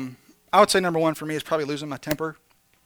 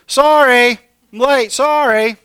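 loud, higher, careless apology; late friend; 7 semitones higher, 11 dB louder, slower than usual. Retold or imitated. Late friend climbing out of the car, calling apology. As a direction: call out a throwaway sorry. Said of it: "the first word they say is".

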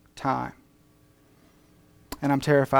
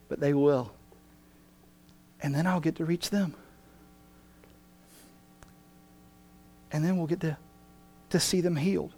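gentle reply; 2 semitones lower, 6 dB quieter than usual. Acted. Preacher voicing Jesus answering the church, soft and tender. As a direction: speak softly like a patient master.

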